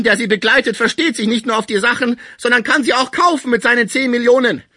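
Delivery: high, loud furious outburst; angry. A narrator's reading. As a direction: rage at the valuers. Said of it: "rief Pons unwillig".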